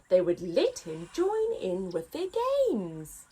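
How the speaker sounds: lively picture book read-aloud; story narration. A narrator's reading. Narrator reading to children, lively.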